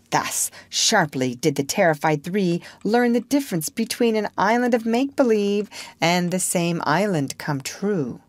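usual speaking voice

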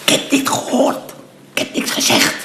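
voiceless secretive whisper. Acted. Accomplice whispering he saw nothing, hushed. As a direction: swear silence under your breath.

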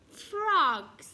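high, loud outraged call; bossy shout, falling at the end; yelled. A narrator's reading. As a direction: yell at the culprits. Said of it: "Duck yelled".

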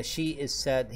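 said very slowly and deliberately for learners; slow model sentence. Shown as a demonstration. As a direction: spell it out slowly like a teacher. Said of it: "مثلًا أقول". (نطق بطيء وواضح للطلاب)

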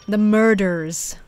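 slow, exaggerated American "murders"; put-on American accent. Shown as a demonstration. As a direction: lean hard on the American R's, slowly. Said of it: "I have to say murders in an American accent".

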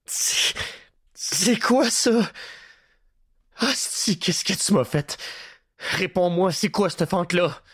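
horrified at the hole in his belly; panicked. A narrator's reading. Prisoner panics about the slit in his stomach.

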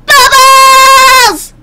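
very high, loud, held-out yell. Acted. Repeats it more intensely.

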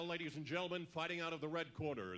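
usual speaking voice

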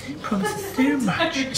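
high, slow mock posh voice. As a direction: imitate her posh voice slowly. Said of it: "You always put your posh voice on".

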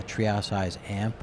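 ordinary talk, nothing marked

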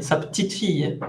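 unstressed quick "petite", falling on "fille"; stress on fille. Shown as a demonstration. Teacher repeating the granddaughter version, "petite fille" run together. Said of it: "la entonación más importante está en fille".

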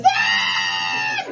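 very high-pitched mock scream; horror movie scream. Movie girl faced with giant ants, screaming. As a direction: let out a shrill B-movie scream. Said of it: "the girl goes".

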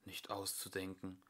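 usual speaking voice

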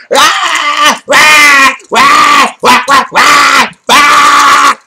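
loud, high-pitched repeated "rah" wails; cardinal fire cry. Acted. Astrologer imitating cardinal fire energy, loud baby-like cries. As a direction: wail out raw bursts like a demanding baby. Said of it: "away from the cardinal fire".

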